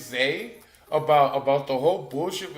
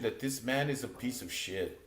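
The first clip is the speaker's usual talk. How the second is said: ordinary talk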